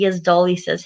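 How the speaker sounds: ordinary delivery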